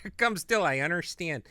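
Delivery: loud, high mock Chicago accent. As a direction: echo the joke in a broad Chicago accent. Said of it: "my favorite word in the Chicago accent".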